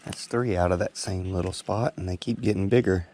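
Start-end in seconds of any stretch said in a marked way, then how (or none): none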